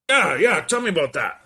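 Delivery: high, loud boss impression; Peter Parker's boss. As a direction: press for details as the brash boss. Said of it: "he had, like, the voice of".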